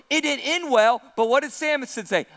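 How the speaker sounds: ordinary talk, nothing marked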